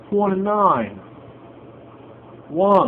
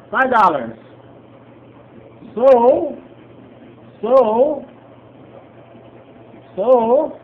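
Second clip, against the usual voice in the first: excited; 4 semitones higher, 7 dB louder, much slower than usual. Real, not acted. Winning again.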